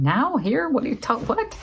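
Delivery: high, baffled retort; incredulous reaction. Retold or imitated. Woman recalling her reaction to an odd homework request, incredulous. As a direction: react with mock disbelief, pitch jumping up.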